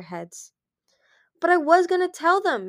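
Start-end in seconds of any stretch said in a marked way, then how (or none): 1.4-2.7 high, loud defensive protest. A narrator's reading. Moon defending her secret to her friends, protesting. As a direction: object hurriedly in a raised voice. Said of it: "Moon protested".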